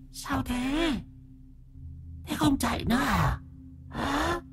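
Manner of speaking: high ghostly taunt, rising to a loud call; eerie ghost voice. A narrator's reading. Ghost woman taunts the exhausted old man in an eerie high voice. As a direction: lure him back like a ghost. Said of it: "giọng nói ghê rợn của nó".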